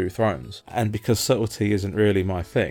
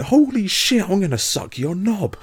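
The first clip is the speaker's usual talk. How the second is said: far higher and louder, hammed-up delirious joy; ecstatic yell; 12 semitones higher, 6 dB louder than usual. Retelling his joy over the game, an ecstatic hammed-up yell. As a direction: scream it like you've lost your mind with glee.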